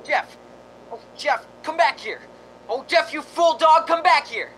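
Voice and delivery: high, loud desperate calls; calling the dog. Acted. Smith calling his runaway dog Jeff, loud frantic calling. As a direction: yell after a dog that bolted.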